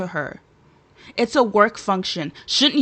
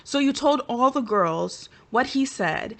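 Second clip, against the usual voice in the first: ordinary talk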